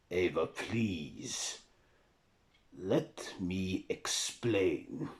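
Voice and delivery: very slow, drawn-out appeal; measured Dracula begging to explain; slow pleading. Acted. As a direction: plead word by word, stretching each one. Dracula pleads with wary Ava.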